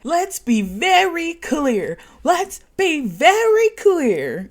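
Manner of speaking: slow, loud, deliberate mock snap; snapping. Rob snapping at Sophie, slow firm mock anger. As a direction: spell it out slowly and firmly. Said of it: "that's when he snaps".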